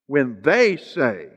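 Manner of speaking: slow phrase with THEY drawn out; stress on THEY. Real, not acted. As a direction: punch and stretch the word "they". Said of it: "now with emphasis on the word they".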